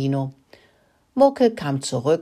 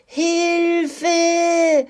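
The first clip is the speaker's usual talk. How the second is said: high, drawn-out scream; shrill sustained scream of a panicked hen; screamed cry for help; 14 semitones higher, 4 dB louder than usual. A narrator's reading. Hen fleeing the stall after a fright, screaming. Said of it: "schrie sie".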